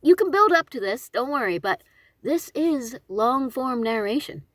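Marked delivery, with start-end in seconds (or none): none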